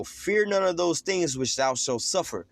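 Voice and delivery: slow, deliberate scripture reading, each word weighed; preacher slows right down on the verse. Shown as a demonstration. Preacher rereads verse to listeners, slow and deliberate. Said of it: "let me read it slow".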